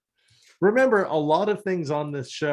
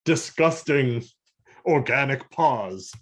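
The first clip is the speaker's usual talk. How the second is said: villain voice; 2 semitones higher, 4 dB louder, slower than usual. Retold or imitated. Voicing Noble cursing his paws.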